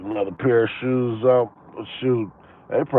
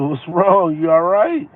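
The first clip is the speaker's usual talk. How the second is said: concerned asking; 7 semitones higher, 5 dB louder than usual. Retold or imitated. Asking upset woman what's wrong.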